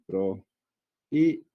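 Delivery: "pro-i-be" with gaps, the I on its own; three separate syllables. Shown as a demonstration. Teacher showing three syllables, spaced out. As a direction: split "pro-i-be" slowly into three beats. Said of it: "prohíbe tiene tres sílabas".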